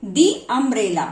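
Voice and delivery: "the" said as "dee" before umbrella, slowly. Shown as a demonstration. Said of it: "इसीलिए हम यहाँ पर क्या पढ़ेंगे".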